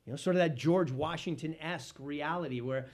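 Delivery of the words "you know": ordinary talk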